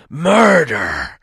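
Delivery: a dragon's sudden roar of rage; roaring murder; furious roar. Acted. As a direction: bellow it out loud. Dragon discovers theft, roars.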